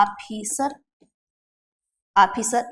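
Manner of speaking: ऑफिसर as 'AA-phee-sar'; wrong aa vowel. Shown as a demonstration. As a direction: begin ऑफिसर on an unrounded long aa. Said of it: "ये सही है क्या? नहीं".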